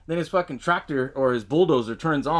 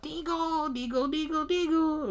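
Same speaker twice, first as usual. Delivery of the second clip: high whispery creature chant; mock her like a little puppet monster; mock gremlin chant; 14 semitones higher, 5 dB quieter, slower than usual.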